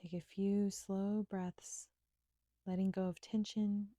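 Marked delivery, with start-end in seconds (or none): none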